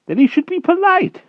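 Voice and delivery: usual speaking voice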